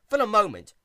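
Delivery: ordinary delivery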